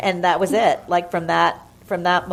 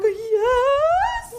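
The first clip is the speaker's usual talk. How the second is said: much higher, squealed yes; ecstatic yes. Retold or imitated. Thrilled bartender accepting Mitzi's offer, squealed much higher. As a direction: squeal yes like a dream came true. Offered the front bar job.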